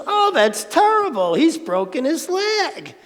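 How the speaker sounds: mock horror at the son's broken leg; dismayed neighbor. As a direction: wail about it like a busybody neighbor. Neighbor voiced in Zen story.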